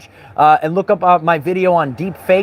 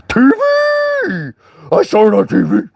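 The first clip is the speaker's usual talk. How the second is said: mocking gullible viewer; 6 semitones higher than usual.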